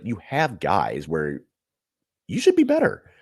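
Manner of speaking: ordinary delivery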